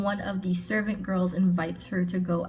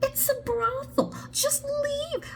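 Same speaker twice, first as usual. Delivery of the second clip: very high squeaky exasperated plea; mock exasperation; 4 dB quieter than usual. Reader begging the heroine to leave, high-pitched exasperation. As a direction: plead in a strained, sky-high voice.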